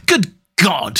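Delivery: shocked cry. A narrator's reading. Arthur reacting to the doctor's hint, shocked. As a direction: cry out in disbelief, without shouting. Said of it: "he cried".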